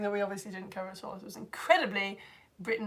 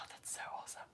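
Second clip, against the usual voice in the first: whisper; 23 dB quieter, much faster than usual. Real, not acted. Reacts to a viewer comment.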